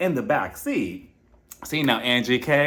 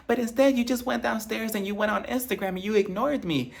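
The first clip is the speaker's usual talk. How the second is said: high, fast nagging complaint; mocking Whitney; 8 semitones higher than usual. Vlogger mimics Whitney scolding Justin at dinner, high and fast. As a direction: whine it at your husband, higher and quick. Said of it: "she's like".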